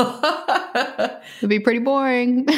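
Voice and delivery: slow, drawn out through laughter; laughing echo. Real, not acted. Host echoes her co-host's joke about a boring show, laughing. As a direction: let the laugh carry the words.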